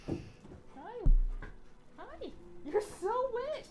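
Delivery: high cooing, slow; pet talk. Real, not acted. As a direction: coo at the dog high and drawn out. Greeting dog again.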